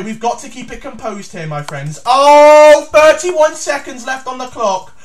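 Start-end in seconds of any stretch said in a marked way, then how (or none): 2.1-4.9 alarmed. Real, not acted. Clock running out.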